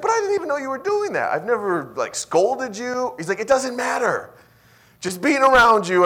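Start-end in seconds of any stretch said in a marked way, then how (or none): 0.0-3.1 high, loud retold protest; incredulous protest. Pastor protesting to his guilty-feeling friend, high and incredulous. 3.4-6.0 high, loud retold outburst; exasperated insistence. Friend brushing off the pastor's protest, loud and exasperated.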